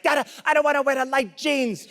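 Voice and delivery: high-pitched mock tantrum of a small son; whine-yell like a protesting kid; screaming child. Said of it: "we are both screaming".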